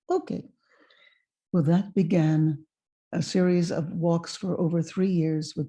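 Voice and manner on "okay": tiny high-pitched voice; imitated girlish agreement; young girl's reply. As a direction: answer small and high, as a girl. Her fourteen-year-old self agrees.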